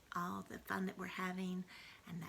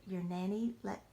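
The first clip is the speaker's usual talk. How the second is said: ordinary delivery, nothing marked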